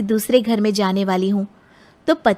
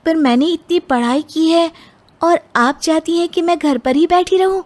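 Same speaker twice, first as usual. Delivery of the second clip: higher, pushing back hard; indignant protest; 7 semitones higher than usual. Acted. Bride objects to in-laws who want her home, indignant. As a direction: object to being kept at home.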